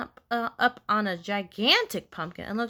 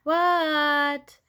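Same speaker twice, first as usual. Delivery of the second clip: long, higher-pitched "what"; Froggy answering a call; 5 semitones higher, 3 dB louder than usual. A narrator's reading. Froggy answering his teacher, drawn-out higher call. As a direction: stretch it out as a called child answers.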